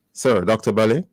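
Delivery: normal talking voice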